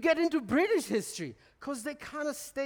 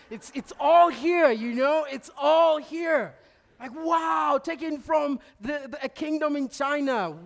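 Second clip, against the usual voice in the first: much louder and higher, bursting with wonder; awed excitement; 5 semitones higher, 8 dB louder than usual. Real, not acted. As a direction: let the amazement burst out loud. Awed at treasures in London.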